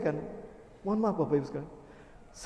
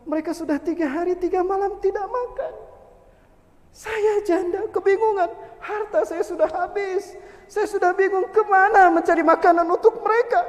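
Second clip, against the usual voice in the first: higher, rising anguished plea; lamenting widow; 8 semitones higher, 6 dB louder than usual. Acted. Widow to the shoemaker, higher anguished lament. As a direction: pour out her despair.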